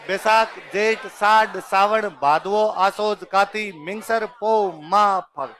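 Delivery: months drawn out slowly and loud, one by one; slow model recital. Shown as a demonstration. Teacher models the full month list, slow and loud. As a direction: chant the months slowly for the class. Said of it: "बोलो देखिए सारे ऐसे कैसे बोलो?".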